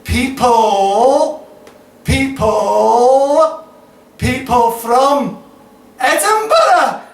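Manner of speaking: loud, high, drawn-out cry; theatrical high-pitched outburst in verse; rising climax. Acted. Poem builds to accusing climax.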